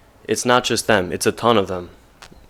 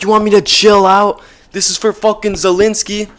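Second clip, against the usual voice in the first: put-on high, loud, indignant young woman; outraged girl's voice; 10 semitones higher, 6 dB louder than usual. Girl furious at soldier's order, high outraged yell. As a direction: fling it back at him, shrill with disbelief.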